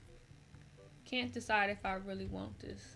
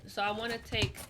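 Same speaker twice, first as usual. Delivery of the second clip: ordinary talk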